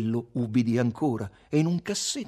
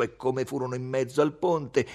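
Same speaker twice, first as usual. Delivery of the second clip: ordinary delivery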